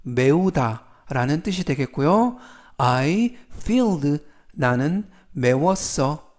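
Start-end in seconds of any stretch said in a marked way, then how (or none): 2.8-4.2 I filled, very slow, spaced out; careful English reading. Shown as a demonstration. Teacher reading the example sentence slowly. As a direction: read I filled slowly for learners. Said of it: "이때 이디를 빼고 fill 하면".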